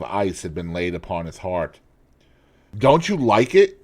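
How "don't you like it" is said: a raised cry, stung by silence; cried out. A narrator's reading. Painter crying out to the silent lad, stung. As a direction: call out hurt at the lad's silence. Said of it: "cried Hallward at last".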